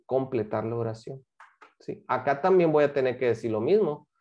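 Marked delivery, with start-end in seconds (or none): none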